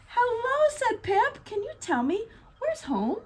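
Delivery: high, small penguin voice; lost little penguin asking the gull. Acted. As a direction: ask sweetly like a tiny chick. Pip asks the gull.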